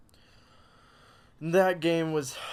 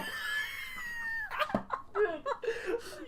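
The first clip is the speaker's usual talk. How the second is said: laughing fit. Real, not acted. Breaks up mid-line.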